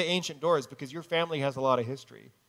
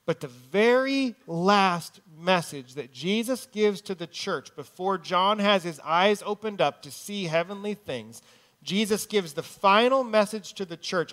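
raised, building sermon voice; preaching at full force; 4 semitones higher, 5 dB louder than usual. Acted. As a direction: build it up from the pulpit. Builds to the key verse.

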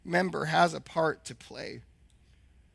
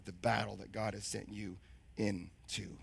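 whispering; 11 semitones lower, 13 dB quieter than usual. Real, not acted. Trails into a whisper.

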